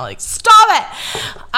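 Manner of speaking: high, loud snap at herself in jest; half-joking order to herself to stop; mock self-scolding. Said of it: "and I'm like".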